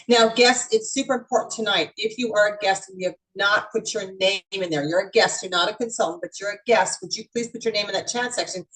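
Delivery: normal talking voice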